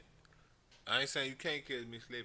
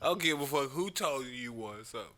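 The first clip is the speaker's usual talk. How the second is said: ordinary talk, nothing marked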